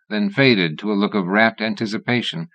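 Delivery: ordinary delivery, nothing marked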